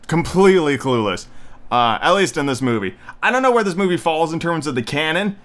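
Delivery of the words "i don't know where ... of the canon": normal talking voice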